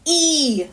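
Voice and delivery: E held as a long "ee". Shown as a demonstration. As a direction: stretch E out like "see". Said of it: "it's a long E sound".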